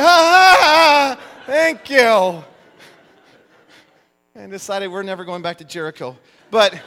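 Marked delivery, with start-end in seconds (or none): 0.0-2.4 high, loud, slow flustered gasps; startled American thanking host mid-kiss; startled thanks. Retold or imitated. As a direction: stammer out thanks in shock. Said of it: "And I'm like".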